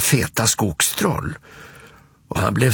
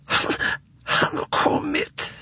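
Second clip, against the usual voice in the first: put-on high, thin, frightened toddler squeak; small frightened voice; 4 dB quieter than usual. Småtting huddled against Alfons hearing something, frightened peep. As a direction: peep haltingly, scared and tiny. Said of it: "piper med liten rädd röst".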